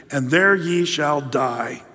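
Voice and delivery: usual speaking voice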